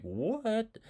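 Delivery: high, puzzled student; mock-baffled, as if lost; confused what. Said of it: "you're like".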